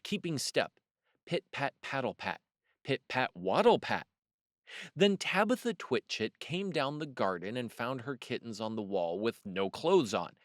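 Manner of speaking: plain reading voice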